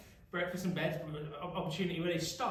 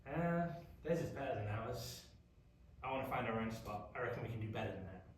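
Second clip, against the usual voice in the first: grumbling tribe; 6 semitones lower, 7 dB quieter than usual. Retold or imitated. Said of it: "turned around and go".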